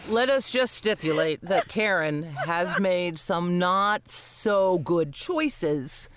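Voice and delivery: normal talking voice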